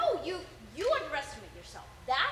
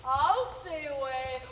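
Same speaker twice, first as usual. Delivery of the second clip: low villain drawl swelling louder; Sideshow Bob; 5 semitones lower, 6 dB louder, slower than usual. Acted. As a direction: start low, build through a long FOREVER. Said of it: "That's the line".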